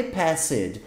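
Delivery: "passed" in two syllables, pass-ed; wrong pronunciation. Shown as a demonstration. Teacher saying what was not said, "passed" as pass-ed. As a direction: add a spoken E before the d in "passed". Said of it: "Eu não falei passed".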